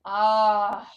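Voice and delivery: loud, drawn-out storybook dismay; mock disgust. Reader showing toddler dirty cat, slow exaggerated yuck. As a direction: react big to a mucky cat.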